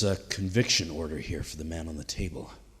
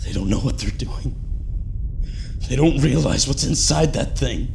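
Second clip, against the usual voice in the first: higher and louder, frantic as the medics hold him down; desperate alarm; 7 semitones higher, 7 dB louder than usual. Acted. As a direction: fight the restraints and shout the warning. Held down, warning about the device.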